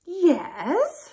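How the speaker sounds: high, loud, regal yes; very grand voice. A narrator's reading. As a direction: answer like a very posh queen. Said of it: "said a very grand voice".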